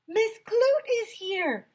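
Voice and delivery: high, slow cheering shout; excited yell. A narrator's reading. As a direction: call out the news like a thrilled kid. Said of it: "everyone sh-, yelled".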